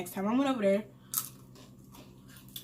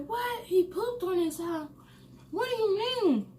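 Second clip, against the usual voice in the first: much higher imitated disbelief; incredulous aunt; 11 semitones higher than usual. Aunt learning her son pooped himself, imitated high incredulity. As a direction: mimic an aunt's high, shocked questioning.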